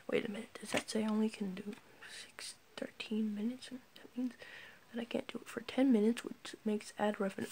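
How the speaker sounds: very soft, mostly whispered muttering; whispered aside. Real, not acted. Vlogger puzzling over ad revenue off the record, whispering. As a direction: think aloud under your breath. Off-camera aside about video length.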